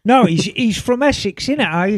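chatty, hammed-up Essex accent. Co-host asked for the listener's Essex accent, playful Essex accent. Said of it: "Can you do that accent".

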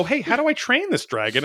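high, mock-clueless voice; naive question. Dog owner asking for dragon training tips, mock high naive voice. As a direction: ask it like a baffled new pet owner. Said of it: "and I go".

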